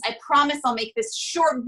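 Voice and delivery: ordinary delivery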